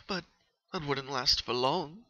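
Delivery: usual speaking voice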